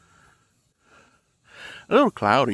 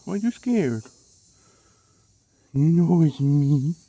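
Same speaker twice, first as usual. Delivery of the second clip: soothing dog talk; 4 semitones higher, 5 dB louder than usual. Real, not acted.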